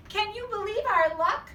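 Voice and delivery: high and loud, bursting with delight; thrilled Mrs Watson. A narrator's reading. Mrs Watson to her husband, high, loud and overjoyed. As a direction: squeal it with joy.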